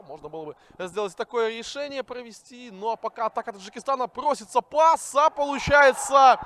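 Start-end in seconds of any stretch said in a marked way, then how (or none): 4.1-6.4 excited. Real, not acted. Goal scored live.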